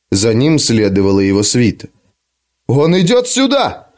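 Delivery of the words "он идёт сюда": shout. Acted. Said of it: "крикнул Том".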